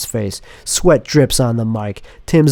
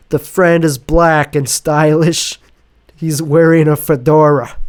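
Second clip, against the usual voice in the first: ordinary delivery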